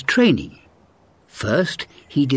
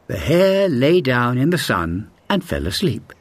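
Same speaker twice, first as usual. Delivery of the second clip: plain reading voice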